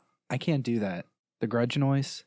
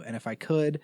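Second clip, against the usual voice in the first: normal talking voice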